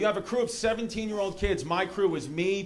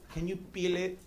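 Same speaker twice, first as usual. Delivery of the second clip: soft mock-French request; French accent; 5 dB quieter than usual. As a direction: imitate an entitled French player, softly. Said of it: "like, French".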